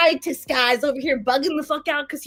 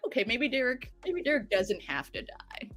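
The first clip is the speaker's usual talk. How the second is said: grudging quiet thought; 2 semitones lower, 9 dB quieter than usual. Retold or imitated. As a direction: concede it under your breath. Grudging inner thought about Derek.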